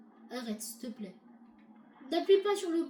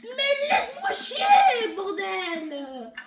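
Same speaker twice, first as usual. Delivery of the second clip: long, drawn-out high yell; exasperated shout; 4 semitones higher, 7 dB louder, much slower than usual. Pretend. Player wants to be left alone, exasperated shout. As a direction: bellow it slowly in exasperation. Yelling at the game character intruding.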